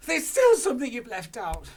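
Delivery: high, very loud insisting; Mister Cranky insists, high and loud; insistent father. Acted. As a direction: push George on. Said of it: "Mister Cranky said".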